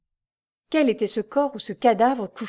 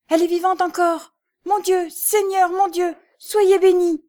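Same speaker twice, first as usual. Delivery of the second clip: joyful thanks; 11 semitones higher, 7 dB louder than usual. A narrator's reading. Jeanne thanking God Lucie lives, very high and loud. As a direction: pour out thanks to God, overwhelmed. Joyful prayer over living girl.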